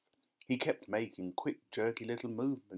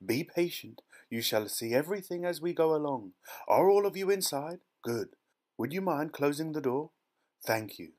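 high, lilting, fluty instructions; shepherd the group in with cheerful courtesy; chirpy eccentric host; 6 semitones higher, 5 dB louder than usual. A narrator's reading.

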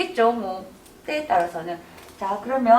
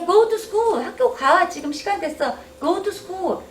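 mom ordering; 8 semitones higher than usual. Retold or imitated. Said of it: "엄마가 야".

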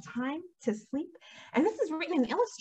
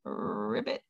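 much lower, croaky frog ribbit; frog croak; 7 dB quieter than usual. Shown as a demonstration. Teacher giving the frog's sound as a riddle for the children, croaking. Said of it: "they go ribbit".

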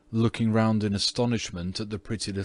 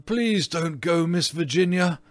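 pleading cry; 8 semitones higher, 5 dB louder than usual. A narrator's reading. Ghost calling Virginia back, pleading. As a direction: beg her desperately to stay. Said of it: "he cried".

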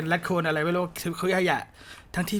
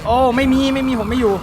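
high, loud panicked denial; frantic "I'm not here" cry; panicked pleading; 7 semitones higher, 8 dB louder than usual. Real, not acted. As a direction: beg the monster to go away, higher and louder. Monster searching his hiding spot.

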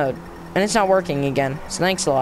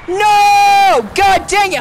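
much higher, louder shouted curse; higher angry yell fading to a curse; furious shout; 10 semitones higher, 7 dB louder, much slower than usual. Real, not acted. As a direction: explode at the game. Game jumps to home screen.

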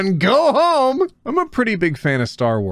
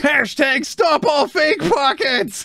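protest shout; 10 semitones higher, slower than usual. Acted. Protest-chant line from the meme.

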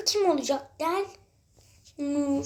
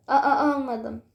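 laughing stammer; 4 dB louder than usual. Pretend. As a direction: stutter through a laugh. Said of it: "Bak kekeliyorsun". (gülerek kekeleme)

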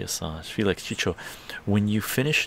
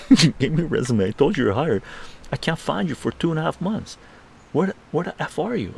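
high, insistent retold complaint; raised-pitch mock outrage; exasperated scolding; 7 semitones higher, 5 dB louder than usual. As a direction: act out a mentor fed up with searching. Mentor berates him on the phone.

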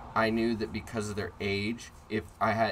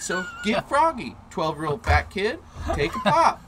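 high, loud goading; hammed-up fighting challenge; acted-out dare; 9 semitones higher, 6 dB louder than usual. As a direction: goad him to take a swing. Acts out daring kid to hit.

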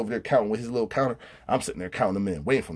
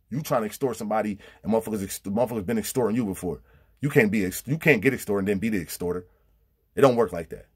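ordinary delivery

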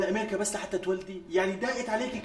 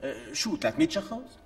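dismissive shrug; 6 semitones lower than usual. Acted. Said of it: "فليكن ثلاثمية شخص".

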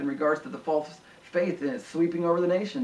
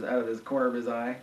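ordinary talk

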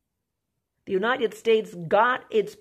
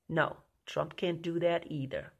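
ordinary delivery

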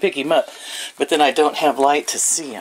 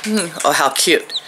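usual speaking voice

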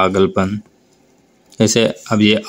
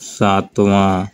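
slow demo word; much slower than usual. Shown as a demonstration. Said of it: "जैसे पाँचवा".

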